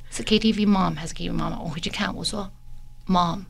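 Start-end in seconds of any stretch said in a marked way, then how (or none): 0.1-1.6 calm quiet retort. Retold or imitated. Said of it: "然后他就说".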